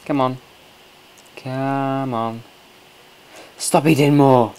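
half-joking loud protest at the guest; exasperated yell. Pretend. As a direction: yell at her to stop eating. Yelling at character eating.

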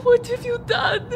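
slow, tearful cry. Acted. Mother weeps over what was done to her son, slow and crying. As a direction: let the sobs break the words.

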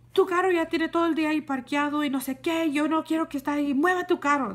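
mock the neighbor's angry order. Retelling the neighbor lady demanding she move her car, angry.

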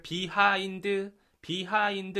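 "비하인드" in even Korean syllables, twice; Korean reading. Shown as a demonstration. Teacher showing how Koreans read it, syllabic "비하인드". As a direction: say "비하인드" with a full "비". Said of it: "이렇게 읽죠".

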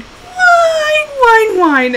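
very high, sustained mock dog whine; teasing mock whine. Owner making fun of her kenneled dog, high mocking whine. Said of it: "I was making fun of her".

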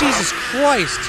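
high, drawn-out startled yelp; startled exclamation. Real, not acted. Player hit by a jump scare, startled cry.

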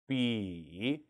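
low dipping 비 that rises at the end; third tone. Shown as a demonstration. Teacher calling 삼성, 비 in a low dipping tone. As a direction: dip 비 low, then bring it up. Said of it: "삼성".

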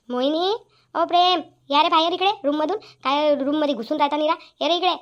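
loud call from across the house; calling out loudly. Acted. Mother-in-law calling her son loudly. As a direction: shout for them to come out of the room. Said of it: "आता काहून आवाज देऊ राहिला?".

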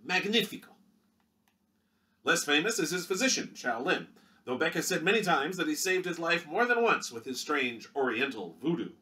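punchy vintage newsreel read; newsreel announcer. Acted. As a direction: sell it like a 1930s Movietone narrator. Newsreel narrator intro.

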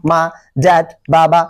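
normal talking voice

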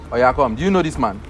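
ordinary delivery

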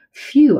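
high loud sigh of relief; relieved phew. Acted. Puppy relieved the monkeys are friendly, loud phew. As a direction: blow out a big relieved phew.

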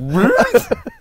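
loud high burst of laughter. Real, not acted. Laughing fit.

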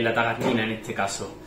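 usual speaking voice

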